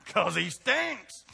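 high, slow, laughing punchline; laughing blunt truth. Retold or imitated. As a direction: say it through a laugh, mock blunt. Coach's blunt answer, laughing.

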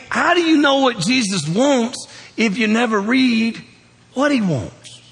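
slow, drawn-out preacher's question, high and loud; preaching at full force. Acted. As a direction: lean into the question like a revival preacher.

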